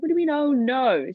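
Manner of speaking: high, loud mock indignation; indignant retort. Child challenging mum's reaction, acted indignant protest. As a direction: throw it back in acted disbelief. Retold quarrel with mum about boyfriend.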